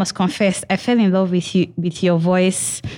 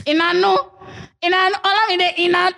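high excited disbelief; 9 semitones higher, faster than usual. Real, not acted. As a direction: rush it in delight. Excited incredulous reading.

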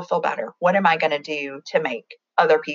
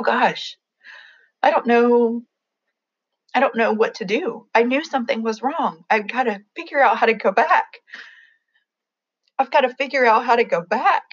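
higher, strained and near tears; fighting back tears while reliving it; real grief cracking through the words; voice breaking; 7 semitones higher than usual. Real, not acted. Reliving learning of sister's death.

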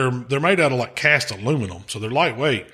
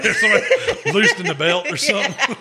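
higher voice broken by laughter; laughing; 7 semitones higher than usual. Real, not acted. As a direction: crack up while telling it. Cracking up while retelling the joke.

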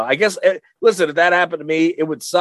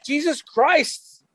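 mock exasperation; 12 semitones higher than usual. Real, not acted. Reacting to prank reveal.

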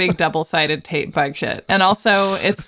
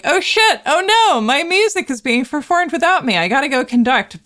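mock panic; 7 semitones higher than usual. Mozart panics, rushes off.

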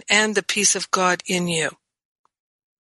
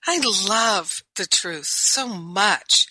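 normal talking voice

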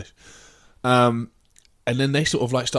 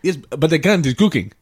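mock Turkish shopkeeper; 3 semitones higher, 6 dB louder than usual. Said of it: "he was like".

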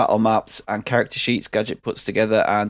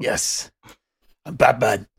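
Batman voice; slower than usual. Pretend. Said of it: "Got Batman on the brain".

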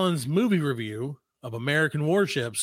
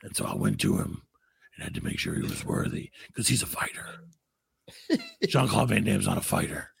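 low, whispery tough-guy send-up; quietly boastful mock action star; Steven Seagal; 7 semitones lower, 4 dB quieter than usual. Host continues Seagal interview story, low sinister voice. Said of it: "And he's like".

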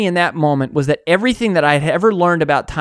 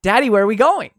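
put-on high, eager toddler asking her daddy; little girl voice; 7 semitones higher than usual. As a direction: play a small girl running up to Daddy. She goes.

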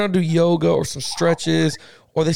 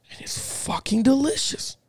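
hushed, whispered relish; awed whisper; 7 semitones higher, 5 dB quieter than usual. Real, not acted. Telling a friend about Cheetos in milk, whispered amazement. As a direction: whisper it like an astonishing discovery.

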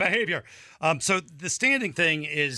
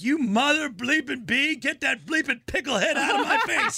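high-pitched mock tirade; angry fan; 11 semitones higher than usual. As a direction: imitate an irate heckler, pitched high. Retells angry fan behind his wife.

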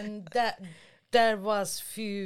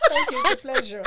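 telephone voice; 2 semitones higher, 6 dB louder, much faster than usual. Acted. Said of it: "The te-, the telephone voice".

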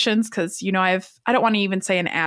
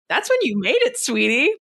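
much higher, sugary put-down sweetie; sassy retort; 4 semitones higher than usual. Retold or imitated. Answering people who fear trolls, sassy and sweet. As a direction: brush off the haters sweetly. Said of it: "I'm like".

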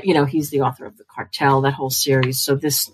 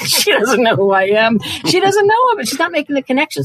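rising higher and faster in disbelief; incredulous inner thought; 10 semitones higher, 3 dB louder than usual. Retold or imitated. Thinking about the woman who got the book, excited high disbelief. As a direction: she doesn't know me, said in giddy high disbelief.

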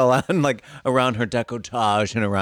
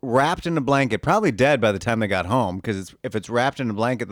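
normal talking voice

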